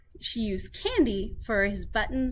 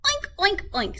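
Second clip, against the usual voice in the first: pig oinks; 11 semitones higher than usual. A narrator's reading. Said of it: "said the pig".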